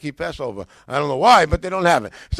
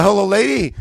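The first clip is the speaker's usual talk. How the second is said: eager phone greeting; 3 semitones higher, 8 dB louder than usual. Retold or imitated. Calling the New York bookseller.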